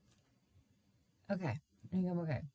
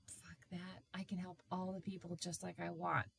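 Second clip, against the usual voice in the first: whispering; 10 dB quieter than usual. Retold or imitated. Her defiant inner reply.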